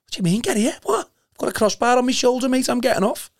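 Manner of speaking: high, incredulous retelling of his own thoughts; mock indignant inner voice. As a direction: play your past self, baffled and refusing. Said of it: "So I'm thinking to myself".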